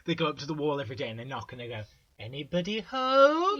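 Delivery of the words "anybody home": high, loud sing-out into the distance; loud high-pitched holler; calling out. Acted. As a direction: call hopefully over a high wall, drawn out. Acting out callers at the wall.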